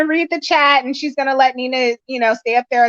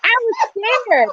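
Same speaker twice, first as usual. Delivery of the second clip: ordinary talk, nothing marked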